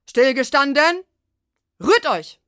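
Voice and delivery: loud, high parade-ground shout; barked drill commands. Acted. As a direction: bark it like a drill sergeant.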